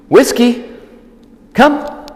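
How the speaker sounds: high, loud call across the yard; high dog-recall call. Shown as a demonstration. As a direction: call her name bright and high. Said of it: "when I actually call her to come".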